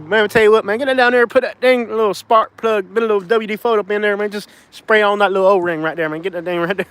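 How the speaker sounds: Boomhauer impression. Vlogger doing a Boomhauer impression for viewers, high and rushed. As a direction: rattle it off like Boomhauer tinkering with an engine. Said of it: "it sounds like Boomhauer".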